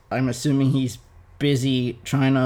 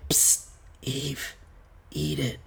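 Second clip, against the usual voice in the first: sneaky serpent whisper; 12 dB quieter than usual. Acted. Serpent tempting Eve.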